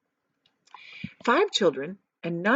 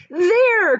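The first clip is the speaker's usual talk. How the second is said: much higher, loud triumphant cry; excited cry; 11 semitones higher, 7 dB louder than usual. A narrator's reading. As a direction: shout it out, pointing at the river. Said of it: "cried Mister Wonka".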